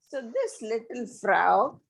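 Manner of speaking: ordinary delivery, nothing marked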